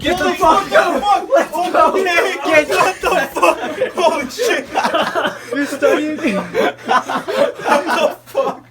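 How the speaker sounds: wild laughing yells; shouting. Real, not acted. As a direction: scream it out while laughing. Bursting in to scare friend.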